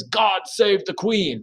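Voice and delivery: slow, hammed-up British accent; a bad British accent, drawn out slowly; mock British royal accent; terrible British accent. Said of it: "That was a terrible accent".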